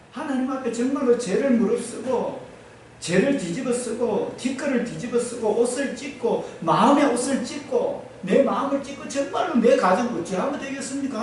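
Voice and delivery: ordinary talk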